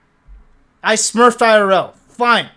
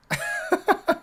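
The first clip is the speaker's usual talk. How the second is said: high squeaky laugh; 8 dB quieter than usual. Real, not acted. Laughing at losing to Larry.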